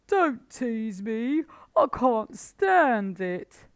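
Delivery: high, loud, slow plea; a starving badger who can't bear it; pained Badger. A narrator's reading. Badger pleads.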